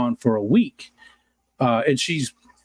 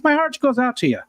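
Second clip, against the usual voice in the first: "My heart goes out to you" in a higher, hammed-up Irish lilt; Irish accent; 11 semitones higher than usual. Shown as a demonstration. Streamer redoes his condolence to Kelly, hammed-up Irish accent. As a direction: play a stage Irishman offering sympathy. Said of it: "it sort of sounded Irish there".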